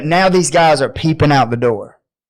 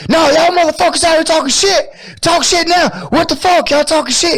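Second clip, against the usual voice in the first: screaming; 11 semitones higher, faster than usual. Retold or imitated. Guy bursting out the door yelling at the group, screaming. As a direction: yell it like a guy picking a fight. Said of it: "And he's just screaming".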